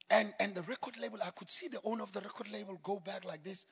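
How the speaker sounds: normal talking voice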